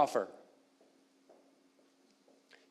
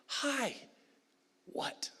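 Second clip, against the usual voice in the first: fearful confusion; 7 semitones higher, 11 dB quieter than usual. Retold or imitated. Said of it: "fearful reaction out of hesitation".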